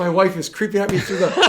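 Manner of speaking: laughing after a real fright; shaky laugh, still startled; relieved laughter. Real, not acted. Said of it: "that scared the shit out of me".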